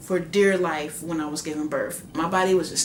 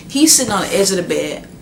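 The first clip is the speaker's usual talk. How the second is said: ordinary talk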